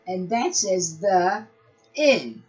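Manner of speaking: bright, lively storybook reading; animated read-aloud for preschoolers; reading to children. Acted. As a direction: read it like a picture book to little kids. Reads picture book to children.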